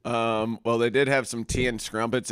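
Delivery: ordinary delivery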